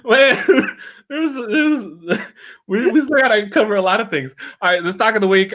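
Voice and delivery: high, laughing through words; giggling while trying to speak. Real, not acted. Still laughing after the joke.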